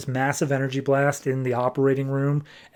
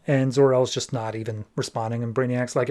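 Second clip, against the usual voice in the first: ordinary delivery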